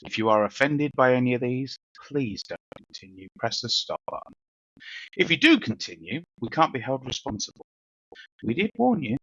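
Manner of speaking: emphatic podcast disclaimer and welcome; show announcer read. Acted. Host reads the warning and welcome to listeners, announcer style. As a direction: read it like a show intro, punching key words.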